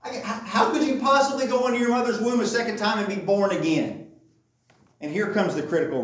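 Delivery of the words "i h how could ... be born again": high-pitched, incredulous mock exasperation; confused, frustrated Nicodemus. Nicodemus answers Jesus about being born again, exasperated and confused. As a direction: act out baffled protest, voice pitched way up. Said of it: "he's either confused or frustrated or angry".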